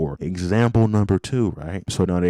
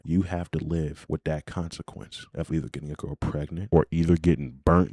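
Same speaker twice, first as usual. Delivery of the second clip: ordinary talk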